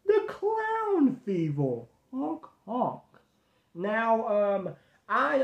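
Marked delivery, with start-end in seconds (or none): 0.1-3.0 slow high clown title voice; goofy clown announcer; silly clown. Acted.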